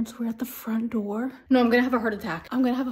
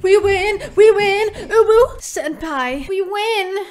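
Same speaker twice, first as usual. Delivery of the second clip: high loud victory cheer; excited cheering; 7 semitones higher, 8 dB louder, slower than usual. Real, not acted. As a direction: celebrate the win loudly. Celebrating win.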